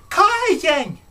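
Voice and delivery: high, calling voice; playing her. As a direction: call it out high and bright. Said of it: "She goes".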